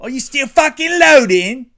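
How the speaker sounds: very high, loud British squawk; over-the-top British heckle; mock British accent. Co-host heckling the still-loading host, exaggerated British yelling.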